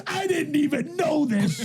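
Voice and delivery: high, drawn-out retold disbelief; mock astonishment. Telling friends how she reacted to the scratch tickets, mock astonishment. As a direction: imitate her disbelief, high and slow.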